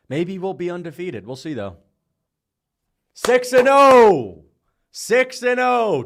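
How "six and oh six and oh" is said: slow, high, loud gloating; drawn-out victory call. Real, not acted. Streamer announcing six and oh, slow gloating shout. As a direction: stretch it out like a chant. Celebrating a winning pick.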